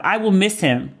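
ordinary talk, nothing marked

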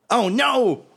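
very high squealing panic; panicked Krieg. Acted. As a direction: yelp it like a cornered little man. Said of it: "he turns around and is like".

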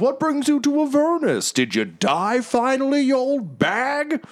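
high, teasing blowhard, stretched at the end; boisterous adventurer. Acted. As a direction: needle an old companion grandly. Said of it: "and Magnuson's like".

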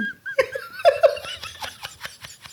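high breathless wheezing cackle; helpless wheezy giggle; wheezing laugh. Real, not acted. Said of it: "You're wheezing".

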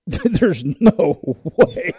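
drawn-out, stretched retold disbelief; slow mock-incredulous there's no way. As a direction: stretch it way out, can't believe it. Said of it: "And I go".

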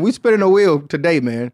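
ordinary talk

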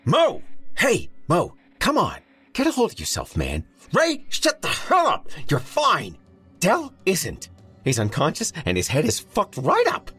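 high, forceful scolding shouts; exasperated yelling. A narrator's reading. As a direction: shout someone out of a panic. Jared scolding his panicking friend.

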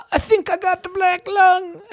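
high, strained put-on invalid voice; hammed-up consumptive complaint; mock sick patient. Mock sickly old-timey patient.

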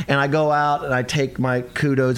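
ordinary talk, nothing marked

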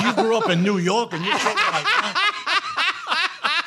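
high, slow, laughing disbelief; mock disbelief. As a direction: drag it out like you can't believe it. Mocking how De Niro threw.

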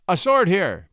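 loud, high call to a servant. A narrator's reading. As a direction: call sharply for a sword. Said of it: "He called to the hovering landlord".

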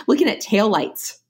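ordinary delivery

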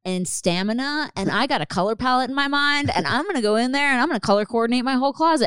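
high, loud, building burst of resolve; hyped-up manic determination. Retold or imitated. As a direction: act out a sudden late-night surge of energy. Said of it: "I do get manic".

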